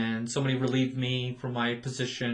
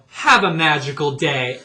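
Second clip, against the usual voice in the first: snarky farewell; 9 dB louder than usual. Retold or imitated. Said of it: "knew exactly what I was doing".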